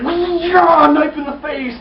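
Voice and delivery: high, loud battle cry; mock attack yell. Fighter knifes someone in the face, mock yell.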